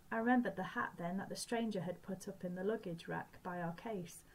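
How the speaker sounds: ordinary talk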